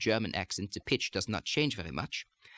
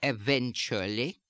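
German-accented "eventually", every syllable stressed, slow, rising end; slower than usual. Shown as a demonstration. Accent coach demonstrating added secondary stress, "eventually" in German accent. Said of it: "unstressed syllables ... will take on a secondary stress".

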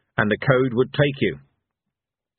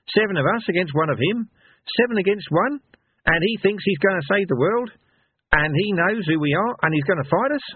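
higher voice of a reasoning woman warrior; Vena; 9 semitones higher than usual. A narrator's reading. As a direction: lay out the odds, pitched up. Vena reckons the odds.

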